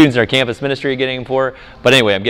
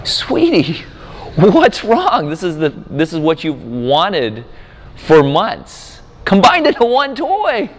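gentle dad coaxing; 7 semitones higher, slower than usual. Retold or imitated. Father soothing his upset toddler, high gentle coaxing. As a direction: coax a sad little girl sweetly. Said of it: "And I'm like".

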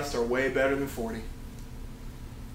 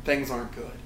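sheepish confession; 2 semitones lower, 7 dB quieter than usual. Retold or imitated. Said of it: "I would have to go".

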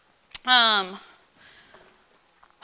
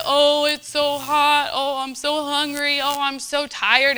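slow, whiny complaining people; a mock whine about the heat and hunger; whining; 4 semitones higher than usual. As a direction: moan it like hungry, tired travellers. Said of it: "And they're whining and complaining".

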